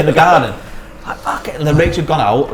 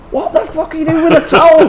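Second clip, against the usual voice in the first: much higher outraged wife; wife walks in on the towels; retold outraged wife.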